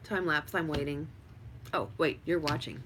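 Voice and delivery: ordinary delivery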